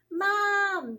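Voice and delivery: high, loud call across the house; yelled. A narrator's reading. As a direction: yell for mom to come. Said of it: "yelled Miranda".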